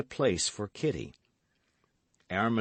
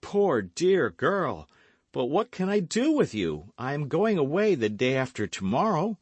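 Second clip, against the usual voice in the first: high, loud gallant hero; pitying gallant young man; 8 semitones higher, 6 dB louder than usual. A narrator's reading. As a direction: exclaim with pity, pitched up. D'Artagnan answers the maid.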